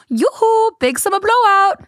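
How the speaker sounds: high sing-song shopkeeper call; sing song yoo hoo. Retold or imitated. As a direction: trill it up high like a cheery Nordic shopkeeper. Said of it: "they sounded like that, that guy in Frozen".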